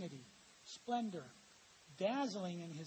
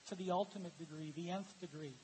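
usual speaking voice

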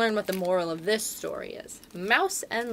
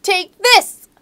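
high, loud mouse battle cry; mouse shouting; 9 semitones higher, 11 dB louder, much slower than usual. Acted. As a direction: shout it bravely as a tiny mouse.

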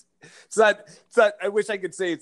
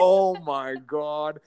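exasperated; 3 semitones higher, 3 dB louder, much slower than usual. Retold or imitated. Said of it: "I'm like".

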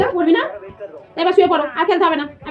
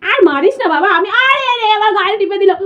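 mock hurt whine; 7 semitones higher, 5 dB louder than usual. Mother mock-complains baby pinched her.

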